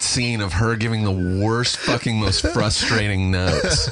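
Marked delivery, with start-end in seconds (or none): none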